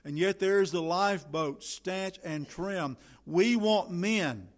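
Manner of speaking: slow, urgent pulpit cry; preacher calling men to the lifeboat; impassioned appeal. Acted. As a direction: plead for rescuers. Shipwreck appeal.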